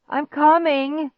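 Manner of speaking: high, slow, loud call; attempted shout. A narrator's reading. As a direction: draw out the urgent call. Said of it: "I tried to shout".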